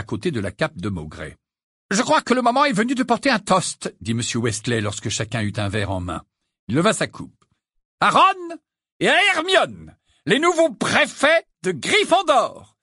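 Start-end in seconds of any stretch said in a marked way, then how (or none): 1.9-3.9 high, festive announcement; cheerful host. A narrator's reading. Father calling guests to raise a glass, festive. 8.0-12.8 high, loud, slow proclamation; rousing toast. A narrator's reading. Father toasting the new prefects before guests, rousing.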